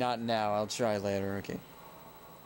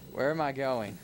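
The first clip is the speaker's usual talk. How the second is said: ordinary talk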